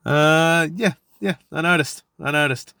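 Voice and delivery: knowing sarcasm. Retold or imitated. Replying to drunk colleague.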